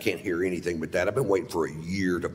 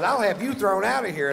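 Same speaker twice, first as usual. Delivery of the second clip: much higher, louder outraged woman; shrill threat; 13 semitones higher, 4 dB louder than usual. Retold or imitated. Woman threatening to get him thrown out, shrill outrage. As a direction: squeal the threat like an indignant patron.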